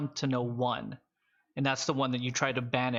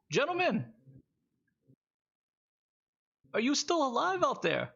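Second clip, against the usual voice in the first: high-pitched game character calling out; high voice hailing friends in the distance; Alistair; 12 semitones higher than usual. Acted. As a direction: pitch it way up, calling to the party from afar. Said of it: "It's the voice of Alistair".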